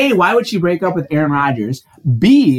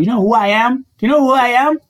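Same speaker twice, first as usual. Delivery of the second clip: mock self-important, pitched higher; 9 semitones higher than usual. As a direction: puff up like a big shot. Mock celebrity boast.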